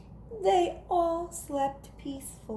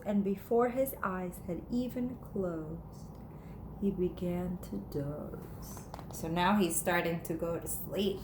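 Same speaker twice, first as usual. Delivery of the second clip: soft, drowsy lower voice; sleepy; 5 semitones lower, 7 dB quieter than usual. A narrator's reading. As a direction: fade off as if dozing. Flea falls asleep.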